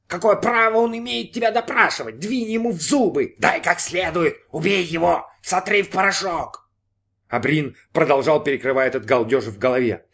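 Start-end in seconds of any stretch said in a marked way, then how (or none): 0.1-6.7 high, egging-on voice in the head; frenzied mock yell. A narrator's reading. Inner voice urges Brin to attack policeman, high frenzy. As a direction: incite him in a high, urgent voice. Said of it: "завопил голос".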